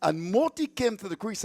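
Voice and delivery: usual speaking voice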